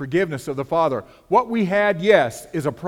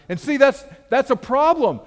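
excited exclaim; 9 semitones higher, 5 dB louder than usual. Real, not acted. Preacher exclaims mid-sermon.

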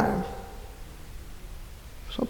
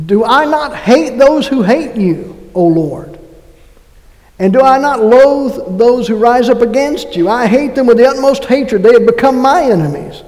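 fervent psalm reading; 5 semitones higher, 5 dB louder than usual. Acted.

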